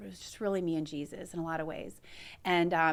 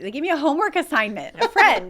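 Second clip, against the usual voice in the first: high, loud, rushed through laughter; laughing disbelief; 6 semitones higher, 11 dB louder, faster than usual. Real, not acted. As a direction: crack up in mock outrage. Amused retelling, friends laughing along.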